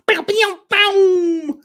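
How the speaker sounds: a high sharp "Boom!" mimicking a whip crack; quick high mock whip crack; imitated whip crack. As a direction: crack it out like a bullwhip sound effect. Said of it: "bullwhip crack like this".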